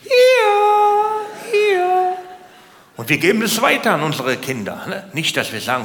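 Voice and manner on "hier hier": very high, sing-song squeaky calling; very high sing-song mock voice of food calling out. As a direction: squeal it like a cartoon snack begging to be eaten. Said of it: "Die sagen".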